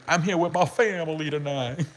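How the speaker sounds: imitating a put-on Southern accent, mocking; fake Southern accent. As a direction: mimic a guy's fake Southern accent for laughs. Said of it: "he faked that fucking Southern accent".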